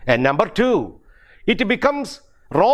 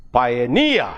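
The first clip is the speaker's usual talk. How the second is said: "pioneer" as pio-NEER, last syllable peaking then falling; stress on the suffix. Shown as a demonstration. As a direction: land the weight on the end of "pioneer". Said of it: "normally on the suffix itself".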